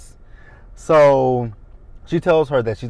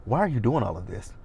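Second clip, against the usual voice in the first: normal talking voice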